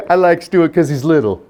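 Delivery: lightly voiced small boy; a hint of a shy-of-grown-up child's voice; little boy. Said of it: "one little boy's like".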